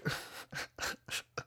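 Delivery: soft, wheezy real laugh; amused laugh. Real, not acted. Storyteller laughing at the kid's complaint, amused laugh. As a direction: laugh quietly at your own story.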